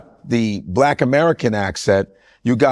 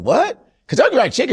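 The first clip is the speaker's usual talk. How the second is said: surprised; 10 semitones higher, faster than usual. Retold or imitated. Shocked at KFC suggestion.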